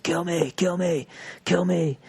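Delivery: high, slow retold plea; imitated desperate demand. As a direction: imitate a man daring the cop, drawn out and high. Imitates man chasing cop with knife.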